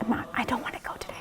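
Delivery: barely voiced, hurried whisper; whispered reluctance. Patient lying in bed dreading chemo, whispered reluctance. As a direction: whisper it weakly, as a worn-out plea.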